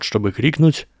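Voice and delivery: usual speaking voice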